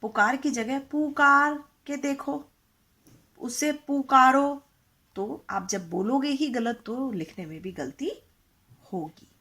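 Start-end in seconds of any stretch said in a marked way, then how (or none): 0.9-4.6 loud drawn-out call. Shown as a demonstration. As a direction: call the name across the room, slowly. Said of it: "इस शब्द को".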